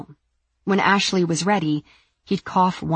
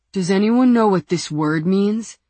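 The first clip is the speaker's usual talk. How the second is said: dim-witted man; 3 semitones higher, 5 dB louder than usual. Acted. Lars asks the room.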